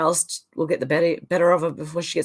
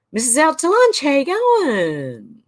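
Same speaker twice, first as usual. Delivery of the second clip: loud, high, drawn-out hello; calling out a greeting; 7 semitones higher, 4 dB louder, slower than usual. Real, not acted. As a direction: hail a newcomer with a long sliding finish. Calls greeting to arriving chat member.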